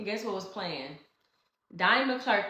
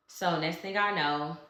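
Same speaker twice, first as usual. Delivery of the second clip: usual speaking voice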